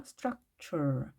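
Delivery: 스트럭처 said slowly with 트 as a "ch" sound; demonstrated pronunciation. Shown as a demonstration. Teacher models an English word for learners, 스트럭처 slowly with "ch". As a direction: draw out 스트럭처, turning the t into "ch". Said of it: "이렇게 발음이 됐죠".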